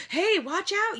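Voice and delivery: high, loud alarmed shout; panicked yell at the runaway car; yelled warning. A narrator's reading. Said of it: "yelled Doctor Carey".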